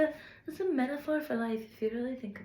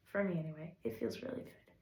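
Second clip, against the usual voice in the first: whispered, much softer; soft aside; 5 semitones lower, 11 dB quieter, faster than usual. Real, not acted. To the viewers as a personal aside, whispered. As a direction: breathe it out quietly.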